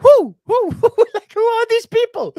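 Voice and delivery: very high, loud hooting with laughs; mock whoo cheer. Host recalling his reaction to strangers, high hooting mock cheer. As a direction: hoot like a wrestling crowd.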